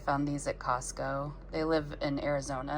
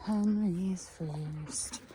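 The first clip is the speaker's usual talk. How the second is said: slow drawn out; 3 semitones higher, much slower than usual. Acted. Letting the hens out.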